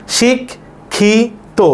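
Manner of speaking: শিখ, খি, তো with a long pause between each; sounded out slowly for counting; syllable by syllable. Shown as a demonstration. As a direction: break it into three separate syllables. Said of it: "এভাবে উচ্চারণ করছি".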